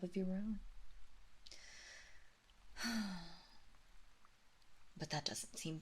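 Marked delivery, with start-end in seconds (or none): 2.8-3.3 quiet falling sigh; soft sigh. Acted. Mistress sighing over the mother's hardships. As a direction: sigh softly.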